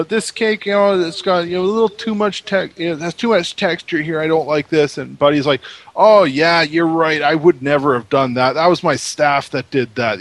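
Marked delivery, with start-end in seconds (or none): 0.0-5.0 higher, lightly put-on fussy critic; picky designer. The designer criticising the staff's cakes, lightly voiced fussy critic. Designer pointing out cake flaws. 5.9-10.2 louder, lightly voiced fawning baker; Buddy agreeing. Buddy blaming his staff in front of the camera, lightly voiced fawning.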